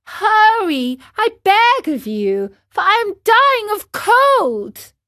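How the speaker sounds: high, loud pleading puppet; urgent begging. Acted. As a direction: beg desperately up to the window. Marionette begs, freezing outside.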